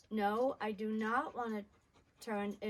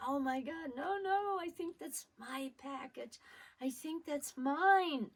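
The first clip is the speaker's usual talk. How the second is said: 1 desperate excitement; 6 semitones higher than usual. Retold or imitated. Child racing kids for package.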